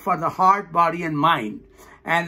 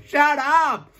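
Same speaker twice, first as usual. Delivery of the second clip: higher, emphatic mock rebuke; mock scolding retort; 6 semitones higher than usual. Teacher silencing morning reluctance, mock scolding. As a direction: cut off the complaint with a playful command.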